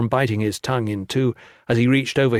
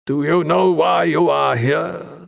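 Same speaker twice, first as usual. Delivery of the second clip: slow, weighty monk question; stern monk voice; 5 semitones higher, 4 dB louder, slower than usual. A narrator's reading. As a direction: ask it slowly and heavily. The monk asks after pause.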